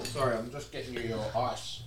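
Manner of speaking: distant, off-mic voice; talking away from the mic; far from mic. Real, not acted. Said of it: "Get closer to the mic".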